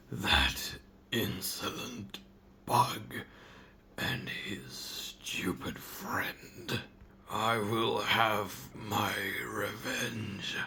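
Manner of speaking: slow, seething villain whisper; seething shrieking spirit. Acted. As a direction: hiss out the threat, slowly, full of spite. Villain skit after the goodbye.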